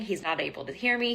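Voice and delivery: normal talking voice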